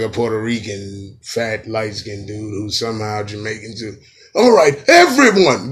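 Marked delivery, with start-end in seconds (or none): 4.3-5.7 high, loud rallying call; mock hype shout. Comic calls everyone to the video, hammed-up shout. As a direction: holler it out to the whole crowd. Hollering hyped mock performer intro.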